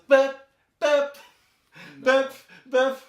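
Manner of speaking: high loud beeping keyboard noises; mock keyboard beeps. Friend mocking keyboardists with high synth beeps. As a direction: bleep it out high like a cheesy synth. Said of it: "the keyboardist usually the guy that goes".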